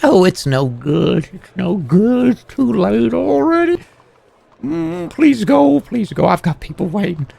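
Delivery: high, fading panicky plea; panicky pleading. Acted. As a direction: beg them to go, rattled. Teller panics, pleads to leave.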